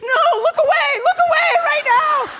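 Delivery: high, loud, laughing panicked yell; mock panicked shout. Husband telling wife not to look, mock shout. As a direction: bark it out in a hammed-up panic. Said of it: "he's like".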